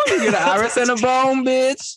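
very high, squealed punchline; high sassy tag. Pretend. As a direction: deliver the joke with a cheeky squeal.

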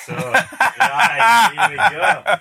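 high, loud laughter through the words; laughing. Real, not acted. Guest asked about his royalty share, laughing. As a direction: talk while breaking up laughing.

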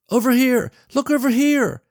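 put-on very high, loud girl calling out; pleading shout. A narrator's reading. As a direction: yell desperately to get a faraway man's attention. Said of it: "directing her pleas to the man on the bus".

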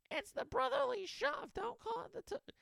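very high, squealed imitation of an angry heckler; mock scream. Lady in a booth corrects his shove talk, mock screaming. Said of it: "Some lady starts screaming from a booth".

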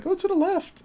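high cartoon toy voice; squeaky ring voice. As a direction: play a tiny confused object piping up. Voicing a ring.

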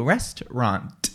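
"restaurant" ending on a popped T; over-pronounced final T. Shown as a demonstration. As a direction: finish "restaurant" with a deliberate crisp T. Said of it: "With a T".

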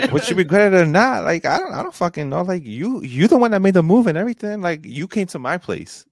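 worked-up, exasperated rant; heated venting. Real, not acted. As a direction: argue your case, pitch pushed up high. Heated venting aimed at the absent woman.

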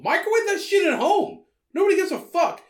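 high, loud, emphatic rant; exasperated rant. Real, not acted. Ranting advice about fish at work.

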